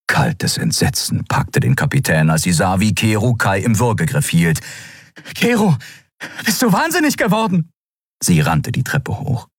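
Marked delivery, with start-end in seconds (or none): 5.3-7.7 high, outraged question; alarmed outcry. Acted. Endriel confronts Keru choking Kai, alarmed outcry. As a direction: cry it out, appalled.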